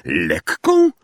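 zdumione pytanie z podniesieniem na końcu; niedowierzanie, że gruba księga jest lekka; zdumienie. A narrator's reading. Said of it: "zdumiał się Ron". (Ron pyta Hermionę zdumiony, niedowierzanie)

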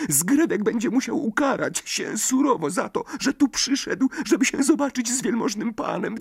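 very high, whimpering elf; a frightened servant pleading; tearful house-elf. A narrator's reading. Dobby frets, near tears. (płaczliwy skrzat)

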